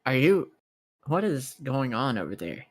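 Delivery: ordinary delivery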